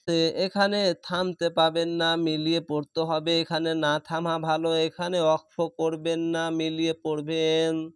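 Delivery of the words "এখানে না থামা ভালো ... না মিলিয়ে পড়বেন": ordinary delivery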